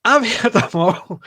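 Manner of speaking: laughing through the words. Real, not acted. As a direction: chuckle it out, amused. Laughing after telling jokes.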